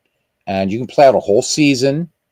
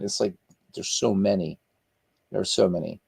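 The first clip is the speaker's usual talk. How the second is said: ordinary talk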